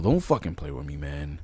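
quiet, low, begging under breath; low pleading mutter, half to himself; hushed plea to the game. Real, not acted. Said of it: "I'm not in the mood".

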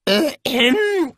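high, nervous hemming and hawing; hesitant stammer. A narrator's reading. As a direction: stall for time, flustered. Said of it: "machte Rincewind".

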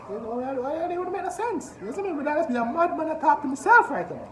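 very high put-on voice; mock madman. YouTuber jokes about talking to himself, comically high-pitched. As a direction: rant to yourself in a silly high pitch.

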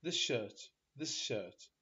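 "this shirt" said as "thishirt", s merged into sh; s becomes sh. Shown as a demonstration. Teacher demonstrating to class "this shirt" as "thishirt". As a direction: blend the s of "this" into the sh of "shirt". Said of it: "وكأنه الس انقلب ش".

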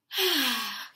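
a long, loud demonstration sigh; deep sigh. Shown as a demonstration. Teacher showing students a deep sigh, loud and long. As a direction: let a big deep sigh out for the class. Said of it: "let out a deep sigh like this".

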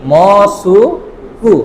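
মসুকু stretched very slowly, each mora separate; Japanese reading. Shown as a demonstration. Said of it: "মস্কো, ঠিক আছে?".

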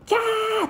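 high-pitched imitated shriek; mock scream. Villagers scream as zombies flood in, mock shriek. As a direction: squeal like a villager in panic. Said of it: "叫び声が聞こえる".

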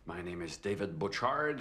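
ordinary delivery

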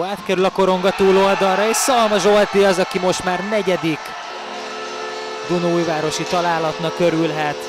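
loud, high goal shout; excited goal call. Real, not acted. Goal call in hockey highlights.

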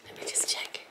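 hushed whisper to herself. Real, not acted. Checking the parcel to herself, whispered. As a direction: breathe the words, no voice.

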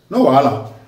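deadpan "No wahala", falling flat and mocking; an ironic, unbothered "No wahala"; dry sarcastic taunt. Real, not acted. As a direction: say "No wahala" like a threat dressed as reassurance. Sarcastic taunt to Abuja rulers.

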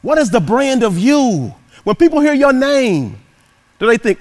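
high, loud, rousing preacher's call; preaching. Acted. Motivational speaker rousing the audience, preaching loud. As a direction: preach it to the whole crowd, big and lifted.

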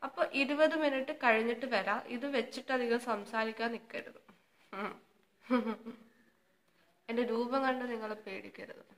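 lips kept still and small; careful restrained mouth movement; talking with face mask on. Real, not acted. Seen 3: Sheet mask covers face throughout; speaker talks with restricted lip movement.

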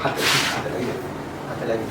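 whispered complaint of pain. Acted. Husband grabbed by his angry wife, whispering in pain. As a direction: hiss that it hurts.

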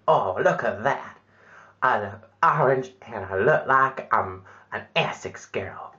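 ordinary talk, nothing marked

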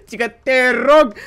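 slow, high, loud mock-polite whine; sarcastic slow singsong; mocking please. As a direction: ham up his polite request.

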